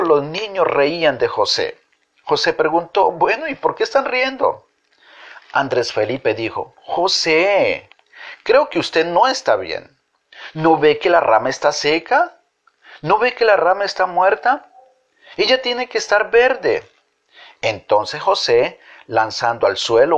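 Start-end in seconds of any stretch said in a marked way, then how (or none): 3.1-4.7 mock puzzlement. A narrator's reading. José feigns puzzlement. 6.8-16.8 put-on higher, insistent child; a child pointing out the obvious; child's voice. A narrator's reading. Andrés Felipe telling José the branch is dead, in a high child's voice.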